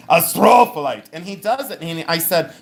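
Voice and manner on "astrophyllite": booming mock yell on STRO; imitated yell. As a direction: repeat his shout for laughs. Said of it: "you don't have to yell it".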